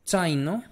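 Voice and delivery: zaino as tsaino, rising; Italian ts sound. Shown as a demonstration. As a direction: softly model zaino with initial ts. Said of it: "la vas a pronunciar como T".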